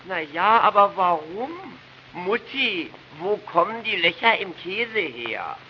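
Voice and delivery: put-on high, slow, whining little boy; whiny boy voice. Boy whining to mother about cheese holes. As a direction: whine the question like a small boy. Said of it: "Eine weinerliche Jungenstimme".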